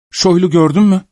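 breathless question. Acted. Breathless boy asks cripple.

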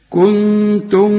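كنتم with the noon hummed; غنة النون في كنتم; nasalised noon. Shown as a demonstration. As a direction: hum the ن in كنتم. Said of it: "ما تقولش كنتم به".

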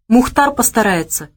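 ordinary delivery

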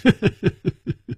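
high, light rippling chuckle. Acted. Victor to his beaten opponent, a light chuckle. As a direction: chuckle easily before replying. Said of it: "楚留香微微笑道".

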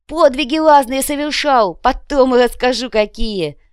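high, loud, boastful oratory; holding forth. A narrator's reading. Копыта boasts of exploits to his kinsfolk, holding forth. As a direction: proclaim your feats to the crowd. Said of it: "продолжал разглагольствовать Копыта".

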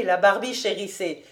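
ordinary delivery

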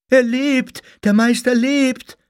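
high, loud jubilant shout; cry of jubilation. A narrator's reading. Followers see the master revive, jubilant cry. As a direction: shout the joyous news, pitched right up. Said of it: "wie ein Jubelschrei gleichzeitig aus allen Kehlen".

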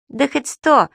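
high, cocky boast; boastful. A narrator's reading. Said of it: "хвастливо заявил Субастик". (хвастливо, с задором)

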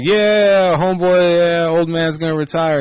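high, loud mock swagger; mock homeboy brag. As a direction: ham up a cocky athlete's boast. Mocking a player's bold announcement.

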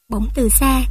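plain reading voice

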